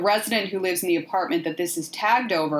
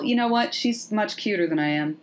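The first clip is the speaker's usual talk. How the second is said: ordinary talk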